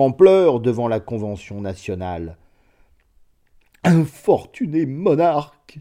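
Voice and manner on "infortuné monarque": cri aigu entre les sanglots; en sanglotant. A narrator's reading. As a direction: pleurer son roi à haute voix. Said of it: "s'écria Redoux en sanglotant". (sanglots aigus et forts)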